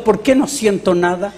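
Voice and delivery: ordinary talk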